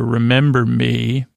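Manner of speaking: ordinary talk, nothing marked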